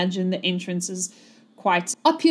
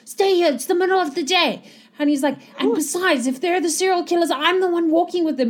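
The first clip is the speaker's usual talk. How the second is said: high, quick, insistent retort; hiked-up pitch, arguing fast; exasperated scolding; 8 semitones higher, faster than usual. Acted. Guard argues with his scared partner, exasperated.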